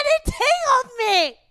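very high, drawn-out squeal; laughing till crying. Real, not acted. Podcaster confessing her embarrassing line, laughing till crying. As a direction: squeal it out, helpless with real tearful laughter. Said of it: "oh my God, I'm crying".

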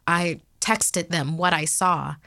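ordinary delivery, nothing marked

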